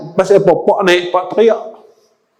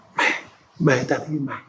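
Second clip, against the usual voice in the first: softly imitating a weak call to come closer; low, much softer retold summons; hushed beckoning; 4 semitones lower, 12 dB quieter than usual. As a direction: beckon gently, voice dropped. Prophet calls Fatimah close, dying.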